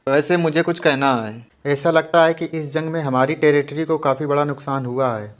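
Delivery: usual speaking voice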